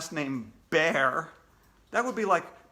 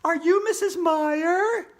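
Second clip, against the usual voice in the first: put-on much higher, louder, slow girl voice; girl asking; 13 semitones higher, 8 dB louder, slower than usual. Andrea questioning the bear, high girl voice. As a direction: ask it suspiciously as a young girl. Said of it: "Andrea asked the bear".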